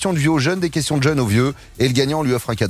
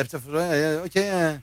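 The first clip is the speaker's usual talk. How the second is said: usual speaking voice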